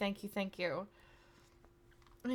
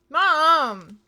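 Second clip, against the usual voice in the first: loud, drawn-out high cry; exasperated yelling; 6 semitones higher, 12 dB louder than usual. Real, not acted. Streamer protesting mom's gift of subs, exasperated yell. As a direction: call Mom out in protest. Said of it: "I'm not yelling at my mom".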